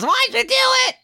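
very high, squeaky mock voice. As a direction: squeak it out like a wounded cartoon character. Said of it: "and he goes".

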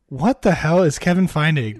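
loud, incredulous disbelief; baffled outburst. Real, not acted. Baffled by strange lyric.

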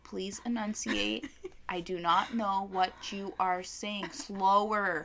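slow, spaced-out words as if to someone incoherent; mock-patient, over-enunciated request; slow, careful plea. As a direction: spell it out slowly for a babbler. Mock plea to babbling child.

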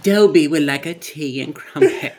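hammed-up posh British accent, laughing; a prim English gent ordering tea, laughing. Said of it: "talk the British accent".

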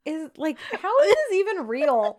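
high, loud mock disbelief; incredulous disbelief. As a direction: act it out as if you can't believe your eyes. Exasperated quote about shirtless scene.

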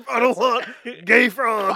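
ranting. Retold or imitated. Impersonating Alex Jones rant.

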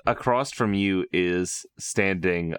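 ordinary delivery

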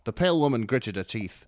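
ordinary talk

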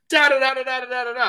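high, nonsense da-da babble; chattering imitation. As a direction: mimic someone rattling on excitedly. Imitates daughter's rapid chatter.

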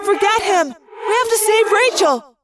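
high, pressing cry with echo; urgent plea. A narrator's reading. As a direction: cut through the chatter and demand action. Urgent plea to save friend.

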